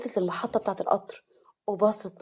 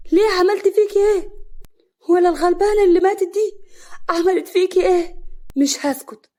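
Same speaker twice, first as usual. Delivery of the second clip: much higher and louder, anguished crying out; tearful and frightened; 8 semitones higher, 8 dB louder than usual. A narrator's reading. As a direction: wail the questions in fear and tears. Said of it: "قعدت على الارض بكل خوف ودموع". (بخوف ودموع وصراخ)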